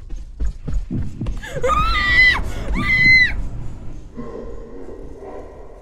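high, loud shrieking laugh; laugh-scream in panic; real frightened shriek-laugh. Real, not acted. Panic in horror game.

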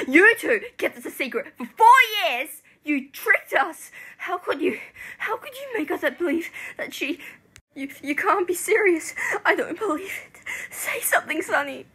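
high, loud, betrayed yelling; upset shouting, demanding an answer; anguished accusation. Acted. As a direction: cry out in hurt disbelief. Betrayed friend accuses Sonny.

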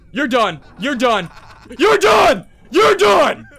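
loud, climbing to a high full scream; angry threat building. Real, not acted. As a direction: repeat it, exploding into a yell. Threatening those who laughed.

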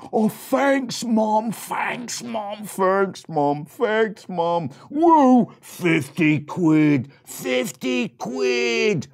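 high, loud, gushing thanks; excited piglet. Acted. Second piglet thanking mum, high excited squeal. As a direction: babble thanks then squeal at the money. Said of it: "the second boy said".

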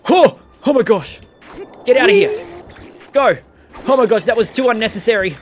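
high frantic yelling; panicked shouting. Real, not acted. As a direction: shout in a panic mid-fight. Panicked fight with monsters.

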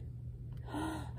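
quiet, sharp intake of breath; startled gasp. A narrator's reading. Dog suddenly vanishes while digging, startled gasp. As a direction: gasp in alarm.

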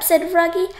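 ordinary delivery, nothing marked

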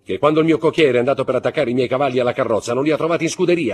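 high, loud, fast demand; indignata. A narrator's reading. Baroness confronts husband over missing horses. (indignata, a voce alta e concitata)

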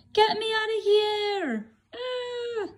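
high panicked cry; scared baby bird. Acted. Bird carried up by the Snort, high frightened cry.

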